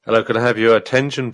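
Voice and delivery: ordinary talk, nothing marked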